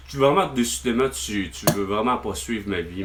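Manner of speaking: normal talking voice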